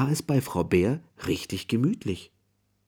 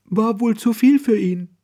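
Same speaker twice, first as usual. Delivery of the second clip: Mrs Bear's voice; 12 semitones higher, 5 dB louder than usual. Acted. Mrs Bear keeps speaking.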